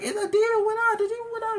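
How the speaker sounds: put-on very high, squeaky child voice; little kid. Boy telling his dad the demon left, squeaky. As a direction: squeak it like a scared third grader.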